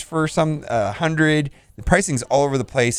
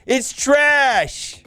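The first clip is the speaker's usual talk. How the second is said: outraged rant; 10 semitones higher than usual. Real, not acted. Ranting over a bad deal.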